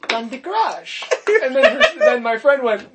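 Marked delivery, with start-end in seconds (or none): 0.1-1.0 put-on higher Filipino mother's accent. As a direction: repeat it as a Filipino auntie. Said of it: "now we're going to accents".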